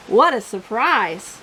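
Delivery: ordinary delivery, nothing marked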